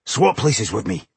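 lightly pleading dwarf voice; put-on dwarf voice, a mild plea; begging. A narrator's reading. Dwarf begs his brother to trade positions, mildly pleading. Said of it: "he begged his brother".

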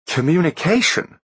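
high, incredulous scoff. Acted. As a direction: sneer at the word in disbelief. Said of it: "scoffed young Master Fowl".

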